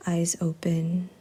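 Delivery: soft, unhurried meditation guide with long pauses; hushed, calm yoga-teacher cueing; guided yoga practice. Real, not acted. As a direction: ease them softly into stillness.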